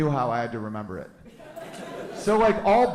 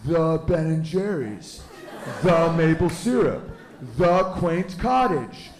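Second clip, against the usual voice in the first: slow mock; 2 semitones higher, slower than usual. Mocking Vermont crowd.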